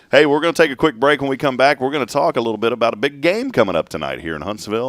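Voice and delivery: loud, upbeat radio host; radio announcer. Acted. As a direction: pump up the break.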